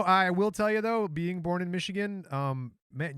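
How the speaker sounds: ordinary delivery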